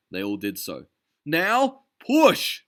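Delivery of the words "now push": very high, loud yell; Ducky leading the charge; battle command. A narrator's reading. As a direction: heave and yell push.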